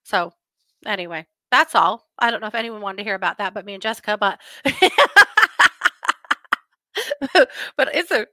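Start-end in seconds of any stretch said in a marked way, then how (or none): 4.4-6.5 loud, high burst of laughter. Real, not acted. Vlogger laughing at her own crazy-case aside, loud laugh. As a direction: laugh it off, tickled.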